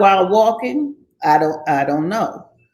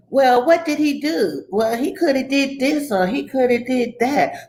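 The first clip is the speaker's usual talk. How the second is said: raised, mildly mocking questioning voice; a hint of a doubtful bystander; 5 semitones higher than usual.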